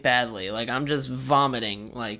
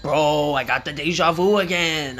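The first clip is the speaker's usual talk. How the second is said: louder, stretched-out stoner exclamation; mock excited; 4 dB louder than usual. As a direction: imitate a buddy blurting out a big revelation. Imitates excited stoned friend Rich.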